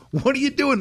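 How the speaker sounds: high, eager question; excited greeting. Retold or imitated. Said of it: "I go".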